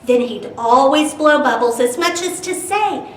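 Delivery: lively storytime narration with bouncy rhyme; animated read-aloud voice; reading to children. A narrator's reading. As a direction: read it like a picture book to kids.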